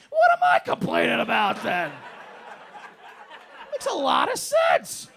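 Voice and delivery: high, loud and slow sarcastic yell; sarcastic yell at airline rules; mock-agreeing shout. Real, not acted. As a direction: shout it like it's obviously absurd.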